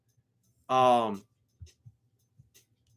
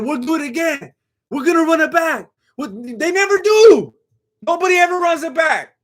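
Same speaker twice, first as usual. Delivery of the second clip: mock the rematch promise loudly; excited; 11 semitones higher, 6 dB louder than usual. Real, not acted. Scoffing at fighters promising a rematch, loud.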